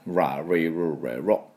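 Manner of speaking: "ラ、リ、ル、レ、ロ" with a deep curled English R; deep R drill. Shown as a demonstration. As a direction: roll the R back in the throat. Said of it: "深いラリレロです".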